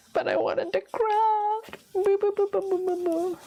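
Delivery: hammed-up boo-hoo; mock crying. Mock sulk about not crafting.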